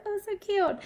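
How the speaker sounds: high, soft little coo; acted-out adoring squeal, kept soft; gushing coo. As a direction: coo it like a charmed mom. Gushing over child's wishes, retold.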